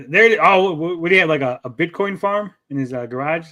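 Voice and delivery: ordinary talk, nothing marked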